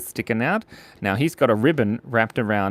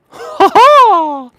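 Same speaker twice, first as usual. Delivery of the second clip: shrill high-pitched ha ha; a goofy squeaky laugh noise; high cackle; 12 dB louder than usual. Pretend. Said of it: "You gotta make that noise".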